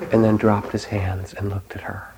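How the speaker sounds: ordinary talk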